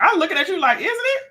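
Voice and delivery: high, laughing question; laughing at her own blank. Real, not acted. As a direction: giggle through the confusion. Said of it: "I don't even know".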